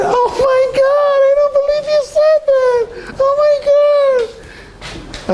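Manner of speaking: woman on the phone hamming up shock, high wailing shriek; theatrical shocked shriek. Retold or imitated. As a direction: shriek it like a woman pretending to be appalled. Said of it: "getting all theatrical on me".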